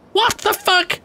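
very high, incredulous outburst; real high-pitched bafflement; startled disbelief. Real, not acted. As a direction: squeal it out in shocked disbelief. Startled by the game.

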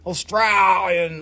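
Australian accent with a long, stretched middle vowel; broad Australian accent. Shown as a demonstration. Seller explaining the mixed accent, in exaggerated Australian. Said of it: "mixture of northern, northern U K and Australian".

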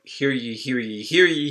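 mock-official cry of hear ye; a friend opens the mock funeral like a herald; town crier announcement. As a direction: announce it like a herald opening a ceremony. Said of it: "he announced".

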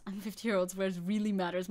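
ordinary delivery

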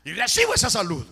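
loud, high, slow confession of belief; fervent shouted prayer, drawn out; declaration of faith. Shown as a demonstration. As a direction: proclaim it to God, stretching each phrase. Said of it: "Entonces lo dice, "Señor, yo creo".

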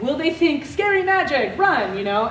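high mock panic, Scary magic, run; mock scared villagers. Imagining village kids fleeing the glow sticks, high mock fright. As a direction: lift the pitch high for a frightened cry. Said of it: "I'm like".

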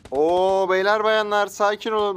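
loud, high, drawn-out appeal; mock crowd-calming call. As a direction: stretch it out, hailing a whole crowd. Mock address to attacking players. (kalabalığa seslenir gibi abartılı)

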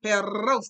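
"Perros" with a loud trilled rr; rolled R. Shown as a demonstration. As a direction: roll the r in "Perros", tongue behind the teeth. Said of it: "tongue is behind your teeth and you roll it".

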